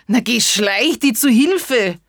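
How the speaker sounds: loud, high fox's cry; calling fox. Acted. Fox shouting to the mouse as he runs. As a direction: shout it while bolting. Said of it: "rief er".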